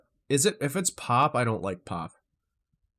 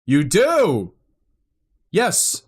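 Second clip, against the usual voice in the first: louder, higher thrilled exclaim; excited cheer; 6 semitones higher, 8 dB louder, much slower than usual. Real, not acted. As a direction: cheer like you just found a fellow fan. Thrilled chatter likes metal.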